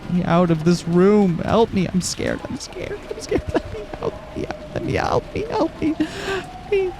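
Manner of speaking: rising, frantic cries for help; panicked screaming. Acted. Trapped girl screams for someone to free her, panicked. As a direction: lose control, beg to be let out.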